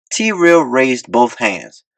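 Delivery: ordinary delivery, nothing marked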